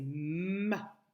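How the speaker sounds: "ma" with a long hummed m; first syllable "ma", m stretched out; syllable with held M. Shown as a demonstration. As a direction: hold the m, then open into "ma". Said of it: "La première syllabe".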